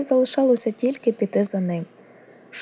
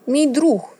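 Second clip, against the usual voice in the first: higher, louder, abrupt correction; sharp retort; 7 semitones higher, 5 dB louder than usual. A narrator's reading. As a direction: cut in sharply to defend a friend. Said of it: "різко сказала Арія".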